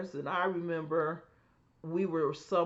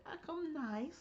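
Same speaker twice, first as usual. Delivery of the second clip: sweet voice; 10 semitones higher, 11 dB quieter than usual. Real, not acted. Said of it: "You have to admit".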